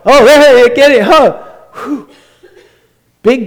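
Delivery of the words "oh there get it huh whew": high, loud burst of mock excitement; excited spotting. Preacher acting out finding it, excited high yelp. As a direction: pounce on it with a yelp.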